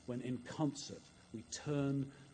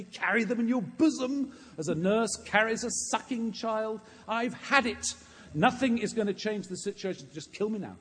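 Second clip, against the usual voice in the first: loud, high-pitched anguished protest to God; impassioned, exasperated lament at full voice; Moses crying out; 10 semitones higher, 8 dB louder than usual. Acted. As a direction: cry out to heaven, utterly fed up. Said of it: "cries out to God".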